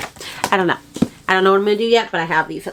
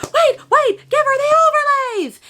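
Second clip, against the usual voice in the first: very high squeal of an imitated author; excited author. Authors rushing to hand the customer overlays, high mock squeal. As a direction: squeak it out like a sudden bright idea. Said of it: "they were like".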